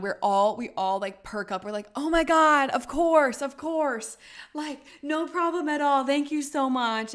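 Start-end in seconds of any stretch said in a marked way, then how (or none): 2.0-7.1 high, loud, bubbly relief; relieved gushing. Retold or imitated. Group thanking the host, relieved high gushing. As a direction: gush with thanks, voice way up.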